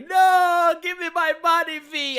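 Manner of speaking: mock whiny plea. Imitating a sobbing fan begging for his money back, high mock whine. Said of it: "You will be like".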